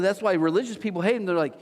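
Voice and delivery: usual speaking voice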